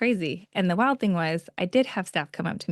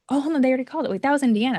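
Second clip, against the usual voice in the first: higher, confused double-take; catching on mid-sentence, pitched up; puzzled surprise; 7 semitones higher, much faster than usual. Retold or imitated. Contestant realising the third place was already called. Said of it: "I'm like".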